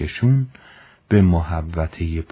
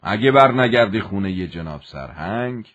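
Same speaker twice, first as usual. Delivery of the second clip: a hint of a threatening officer; stern commander; 3 semitones higher than usual. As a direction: lay out the punishment coldly.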